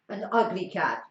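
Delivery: usual speaking voice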